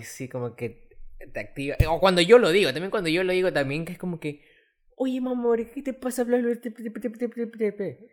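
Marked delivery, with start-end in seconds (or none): 4.9-8.1 high-pitched imitation of an offended friend; mock offended friend. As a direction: act out his outrage, pitched way up. Imita al amigo ofendido. (imitando a un amigo indignado, voz muy aguda)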